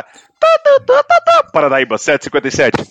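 high, loud radio squawk; taxi radio voice. Retold or imitated. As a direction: mimic a crackling dispatch radio. Imitating radio dispatch. (voz de rádio de táxi)

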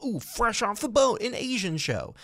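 high, slow ooh of a gleeful network suit; mock excited executive. Host mocking network bosses pitching shows, gleeful. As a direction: gush like a greedy TV executive. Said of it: "they're like".

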